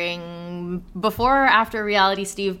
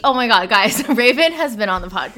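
ordinary talk, nothing marked

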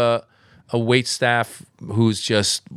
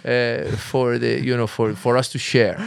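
dad voice; 4 semitones higher than usual. Retold or imitated. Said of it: "yell across the room".

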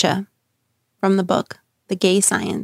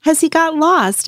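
much higher, mocking jeer from the crowd; mocking question; 10 semitones higher than usual. A narrator's reading. Unbeliever in the crowd mocking the madman, high jeering. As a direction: toss it out as a taunt, pitched way up. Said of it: "asked one".

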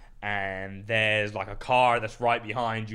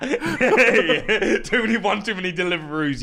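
laughing; 8 semitones higher, 6 dB louder, slower than usual. Real, not acted. Mocking his own weight, laughing.

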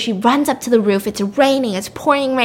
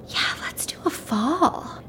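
slow, soft, breathy whisper of a killer; eerie whisper; 5 semitones higher, 8 dB quieter, slower than usual. Retold or imitated. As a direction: savour it in a whisper. Villain agreeing, whispered and slow.

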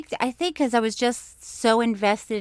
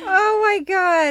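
slow, high, loud disgusted oh my God; shocked groan; 10 semitones higher, 6 dB louder, slower than usual. Real, not acted. Host reacting to the poop story, shocked. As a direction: recoil at the gross story.

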